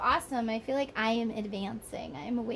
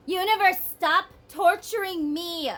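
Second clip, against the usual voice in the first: louder, higher cry to the sky; exasperated plea; 7 semitones higher, 7 dB louder than usual. Real, not acted. Reader calling out to the universe, exasperated and loud. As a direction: beg the universe to stop.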